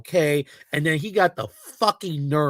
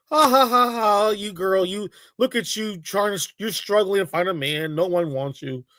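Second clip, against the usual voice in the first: hammed-up jeering "ha ha ha", higher pitched; mocking laughter; 4 semitones higher than usual. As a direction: imitate men jeering at a girl as a joke. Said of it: "they're laughing, like, it's all a joke".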